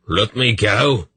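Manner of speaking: half-yell it while struggling to get free; restrained yell. A narrator's reading. Dernick struggles in Belgarath's grip, mildly yelling. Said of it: "he yelled".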